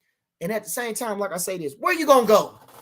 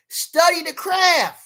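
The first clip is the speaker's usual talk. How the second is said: high, slow, deliberate; slow weighty praise; slow emphatic; 7 semitones higher, 5 dB louder, much slower than usual. Real, not acted. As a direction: lay each word down. Praising Crawford's discipline.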